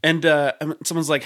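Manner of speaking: ordinary talk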